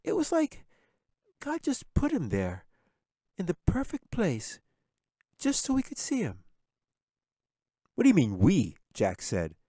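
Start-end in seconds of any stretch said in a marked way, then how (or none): 0.0-6.4 put-on high, airy little girl in awe; lost in a dreamy memory. A narrator's reading. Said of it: "staring off dreamily". 7.9-8.8 snapping. A narrator's reading. Jack snaps at Katie.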